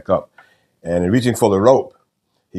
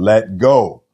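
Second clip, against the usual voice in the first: louder, emphatic divine command; voice of God; 4 semitones higher, 6 dB louder than usual. Retold or imitated. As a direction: give the retold command firmly and louder. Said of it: "the voice said again".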